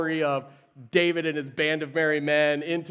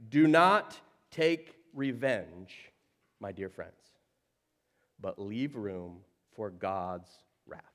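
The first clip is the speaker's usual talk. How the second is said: scripture reading; 6 semitones lower than usual. Acted.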